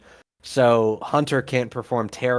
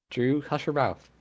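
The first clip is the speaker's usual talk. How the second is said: usual speaking voice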